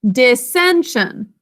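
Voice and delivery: Dissension stretched out, each syllable clear; slow choral model. Shown as a demonstration. Teacher leading pupils to say the word together, slowly. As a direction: say Dissension very slowly for the kids to echo. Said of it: "say it with me".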